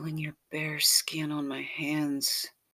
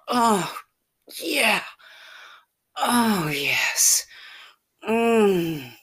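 higher, louder breathy cries of yes; ecstatic moans; 6 semitones higher, 8 dB louder, much slower than usual. Acted. Lover moaning yes again and again, ecstatic cries.

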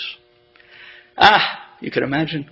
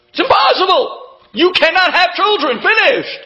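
high, final and scornful; much higher, scoffing verdict; dismissive doctor; 14 semitones higher than usual. Retold or imitated. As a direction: shut the door on all hope. Doctor flatly rejects news.

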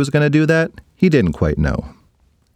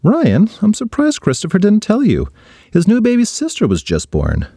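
lightly voiced kind teacher, higher; 10 semitones higher than usual. Teacher kneeling to tell Ryan the news, light higher voice. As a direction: gently break the news to a kid.

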